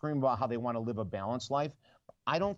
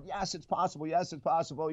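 higher, mock jubilant cheer; excited cheering; 4 semitones higher than usual. Type A people jumping up and down, cheering high. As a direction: imitate type A people celebrating. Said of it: "jumping up and down".

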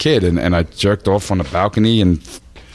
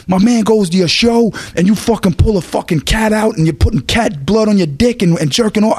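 higher voice retelling the rumour; 12 semitones higher than usual. Retold or imitated. Biggie accusing him over the phone, mock indignant.

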